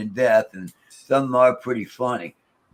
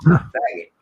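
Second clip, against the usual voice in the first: louder, higher mock ghost jeer; imitating the ghost; 5 semitones higher, 7 dB louder than usual. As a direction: toss out the slur like the spirit. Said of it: "You hear him go".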